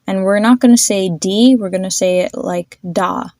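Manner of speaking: ordinary talk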